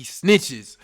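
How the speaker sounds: very loud, slow burst; outraged exclamation. Real, not acted. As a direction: explode on the betrayal. Storyteller outraged at being snitched on.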